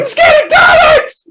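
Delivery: put-on very high, loud, slow frightened kid wail; scared child. Boy tells his dad about Daleks in a high wail. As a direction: wail it like a terrified small boy.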